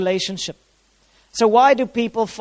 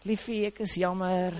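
much softer, an intimate Afrikaans apology; tender apology; 11 dB quieter than usual. Retold or imitated. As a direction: say sorry tenderly to the wife.

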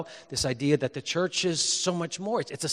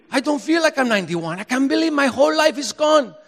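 put-on Spanish-accented old father, climbing higher to a loud lament; 7 semitones higher, 6 dB louder than usual. Father of ninety-one lamenting to his son, Spanish accent. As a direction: imitate Dad's Spanish accent, building in pitch. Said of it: "he would say in his Spanish accent".

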